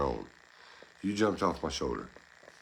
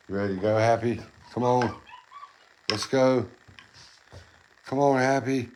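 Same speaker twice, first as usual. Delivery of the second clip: loud, high coaxing call to a pet; 7 semitones higher, 4 dB louder than usual. Real, not acted. Owner calls pet monkey down off the rope, loud high calling. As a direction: call him down cheerfully from across the room.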